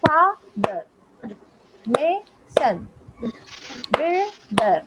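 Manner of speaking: father, mason, builder each cut in two with a gap; syllable-by-syllable demo of father, mason, builder; broken into syllables. Shown as a demonstration. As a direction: say fa-ther, ma-son, buil-der in separate beats. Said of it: "ऐसे एक बार में ही नहीं बोल पाऊंगी".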